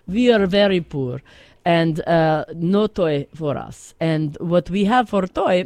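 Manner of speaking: put-on broken English with a heavy Russian accent, reminiscing; Russian-accented grandma character spinning a tale on the radio. Plays Russian grandma Olma telling story.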